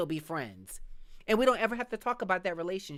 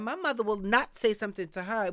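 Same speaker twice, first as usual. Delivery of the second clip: ordinary talk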